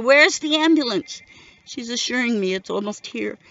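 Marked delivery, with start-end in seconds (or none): 0.0-1.2 high, loud demand for help; retold panicked shout, pitch leaping up; desperate yell. A narrator's reading. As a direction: yell it urgently at an emergency operator. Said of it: "yelling at the nine one one operator".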